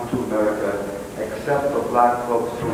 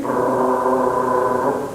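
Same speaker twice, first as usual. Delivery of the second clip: ordinary delivery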